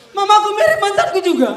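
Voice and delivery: laughing punchline. Acted.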